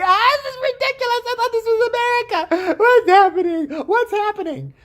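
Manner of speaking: very high hysterical wail; mock panicked nurse. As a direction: cry out in hammed-up alarm. Nurse being thrown against wall.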